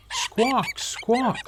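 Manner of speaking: high, squawking hen cry in fright; frightened squawk. A narrator's reading. Chicken Little squawks, frightened by a falling petal: high squawk. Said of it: "cried Chicken Little, frightened by the petal's landing".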